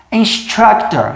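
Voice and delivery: instructor said slowly, stress on the middle syllable struc; slow model word. Shown as a demonstration. Teacher modelling instructor slowly for the class. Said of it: "repare que a tônica está aqui".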